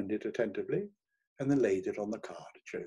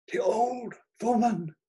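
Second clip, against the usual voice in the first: high, drawn-out cry of shock; involuntary exclamation; 12 semitones higher, 4 dB louder than usual. A narrator's reading. Hermann recognises the winking queen, high involuntary exclamation. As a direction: let the words burst out high, stretched slowly. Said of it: "he exclaimed involuntarily".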